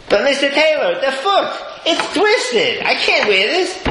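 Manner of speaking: high, pleading complaint voice; whiny groom. Retold or imitated. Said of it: "He goes".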